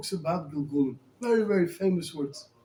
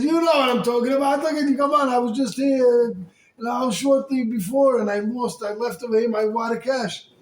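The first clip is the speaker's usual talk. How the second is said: angry accusation; 4 semitones higher than usual. Retold or imitated. Owner accusing the stranger of taking his cash, mock heated. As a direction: push it like a man sure he's been robbed.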